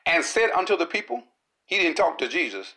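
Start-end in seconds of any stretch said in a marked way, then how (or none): none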